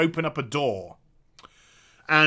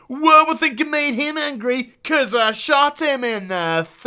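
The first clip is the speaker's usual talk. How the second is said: a hint of a higher, dopey Liam voice; mimicking Liam; 7 semitones higher, 4 dB louder than usual. Streamer parroting Liam's line in a slightly higher dopey voice. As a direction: parrot the character's silly line. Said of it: "And Liam was go".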